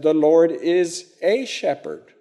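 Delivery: a said "ay", lifted; stress on a. Shown as a demonstration. Teacher gives what David could have said, stress on a. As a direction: lean on a as a full vowel. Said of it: "David could have said".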